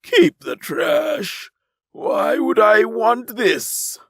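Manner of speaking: high, loud, slow contemptuous sneer. Acted. Alien lord dropping the gun, sneering at the twins. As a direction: toss it away with scorn. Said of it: "he sneered".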